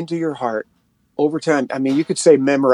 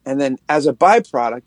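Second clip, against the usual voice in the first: ordinary delivery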